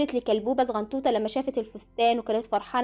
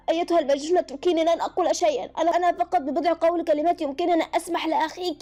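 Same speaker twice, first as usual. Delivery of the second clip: ordinary delivery, nothing marked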